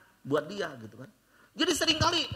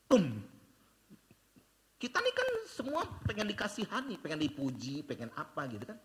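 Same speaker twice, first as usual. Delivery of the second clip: ordinary talk, nothing marked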